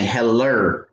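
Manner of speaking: Heller said with a falling tune, HEL-er with an r ending; Bama slang. Retold or imitated. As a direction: imitate the Alabama greeting Heller. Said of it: "That's that Bama slang".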